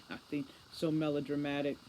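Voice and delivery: ordinary delivery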